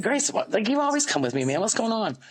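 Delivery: high-pitched disbelief, pushing for an answer; incredulous mom. Retold or imitated. Mom asking her daughter, high and disbelieving. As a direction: act baffled, voice jumping up.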